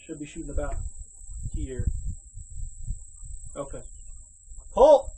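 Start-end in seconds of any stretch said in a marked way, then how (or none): 4.7-5.1 sharp loud call of pull; barking pull for skeet. Real, not acted. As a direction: bark it out to launch the target. Calling for the clay target.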